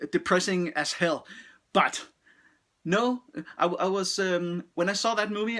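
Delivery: ordinary delivery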